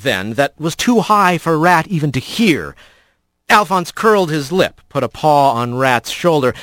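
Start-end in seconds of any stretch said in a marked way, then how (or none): none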